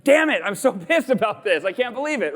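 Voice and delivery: high, exasperated grumble broken by a laugh; mock pissed-off griping. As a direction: play up your annoyance at the timer. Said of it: "And I go".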